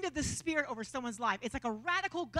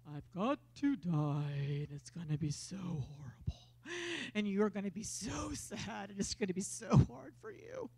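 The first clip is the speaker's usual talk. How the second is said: mock gloomy lament; 5 semitones lower, 3 dB quieter than usual. Pretending Jesus tells disciples his death mournfully. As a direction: drag it out like a mournful farewell.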